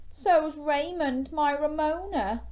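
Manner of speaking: high, drawn-out storybook lament; acted sadness. Gilbert sharing his loss with Rita, high acted sadness. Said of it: "said Gilbert sadly".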